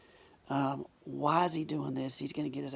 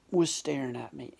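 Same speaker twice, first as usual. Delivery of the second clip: normal talking voice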